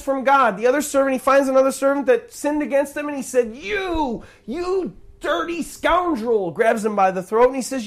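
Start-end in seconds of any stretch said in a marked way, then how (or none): 3.6-6.5 high, drawn-out indignant outburst; acted outrage, pitched well up; angry accusation. Acting unforgiving servant accusing debtor.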